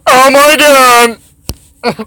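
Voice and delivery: high, loud outburst; excited yell. Real, not acted. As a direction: cry out at the game. Exclaims at game event.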